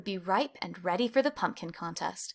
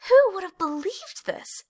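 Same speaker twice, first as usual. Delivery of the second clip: much higher, gleeful exclamation; joyful cry; 10 semitones higher, 4 dB louder than usual. A narrator's reading. Clayton dancing after winning first prize, high and gleeful. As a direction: squeal it with delight.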